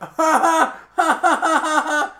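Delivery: big, high, loud cackle; long burst of loud high laughter; loud mocking laugh. Real, not acted. Host reacts to a lame werewolf joke, loud cackling laugh.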